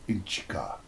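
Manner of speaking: secretive whisper of an invented word; whispered alien word. Shown as a demonstration. Doctor whispering an alien word to his companion, hushed.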